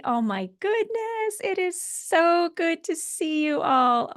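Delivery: very high, slow gushing delight; light up as you see everyone; overjoyed. Real, not acted. Pod leader greeting her friends, high and overjoyed.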